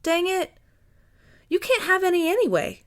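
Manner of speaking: high, indignant huff; exasperated huffy outburst. A narrator's reading. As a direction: huff at him, pitch way up. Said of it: "she huffed at him".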